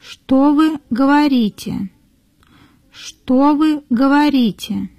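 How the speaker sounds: ordinary talk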